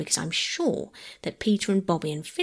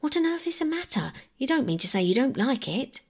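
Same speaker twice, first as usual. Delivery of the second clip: quick, higher, mildly impatient question; a little impatient; 7 semitones higher than usual. A narrator's reading. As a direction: press her for an answer, a touch impatient. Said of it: "perhaps a little impatiently".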